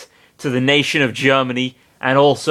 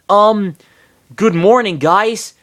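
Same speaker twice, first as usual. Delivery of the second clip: higher, awkward cheerful greeting; nervous Sonic voice; 7 semitones higher, 4 dB louder, slower than usual. Acted. Sonic greeting his friends, higher and sheepish. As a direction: play it like Sonic waking up unaware.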